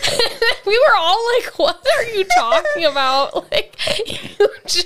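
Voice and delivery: laughing through the retelling, high; can barely get words out for laughing. Real, not acted. Telling friends how Grace fell, laughing.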